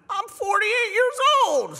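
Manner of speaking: hammed-up dumb little voice. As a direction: squeak it like a goofy kid. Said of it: "I don't know why I do that dumb voice".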